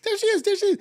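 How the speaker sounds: high, mocking sing-song echo of the clip; mocking imitation. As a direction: parrot the hype voice high and mocking. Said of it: "Why are they talking about her like that".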